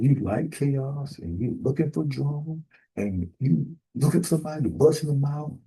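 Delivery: ordinary talk, nothing marked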